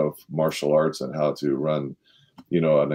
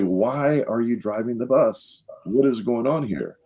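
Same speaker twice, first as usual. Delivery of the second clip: retold upset demand, higher and drawn out; mock indignant questioning, pitched up; upset founder; 6 semitones higher, slower than usual. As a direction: demand an explanation, slow and high without shouting. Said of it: "he got really upset".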